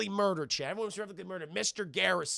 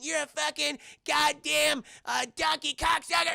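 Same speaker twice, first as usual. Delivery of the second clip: higher, loud cursing shout; mock swearing tirade; 8 semitones higher, 4 dB louder than usual. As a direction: hurl the insults like a bratty kid. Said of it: "saying naughty words to Saddam".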